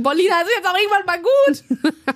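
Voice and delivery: high-pitched mock exasperation; hammed-up fed-up whine; imitated annoyed protest. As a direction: mimic someone at home getting worked up. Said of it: "wird sich da immer tierisch drüber aufgeregt".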